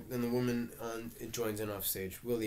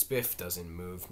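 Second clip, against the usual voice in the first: usual speaking voice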